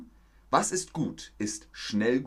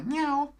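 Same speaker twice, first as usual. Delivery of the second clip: high squeaky meow; playful high meow; cat meow. Pretend. Cat among name ideas.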